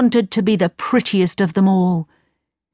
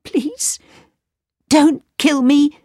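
pleading; 8 semitones higher than usual. Acted.

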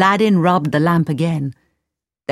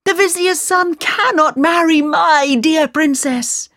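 indignant Aladdin; 11 semitones higher, slower than usual. Acted. Aladdin protests.

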